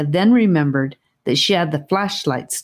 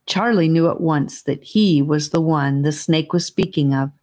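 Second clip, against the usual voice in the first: plain reading voice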